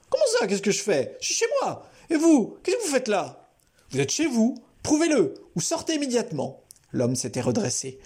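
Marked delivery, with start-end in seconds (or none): 0.1-3.5 high, mocking man's voice; defiant intruder. A narrator's reading. Intruder mocks Albert from the couch, high and sneering. 3.9-6.6 high, firm demand; firm Albert. A narrator's reading. Albert orders the intruder to prove it or leave.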